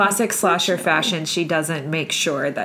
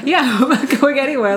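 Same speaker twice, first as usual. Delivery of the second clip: higher, amused echo of her defiance; laughing mock defiance; 6 semitones higher, 4 dB louder than usual. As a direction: repeat her outburst through laughter. Echoes the defiant line while laughing.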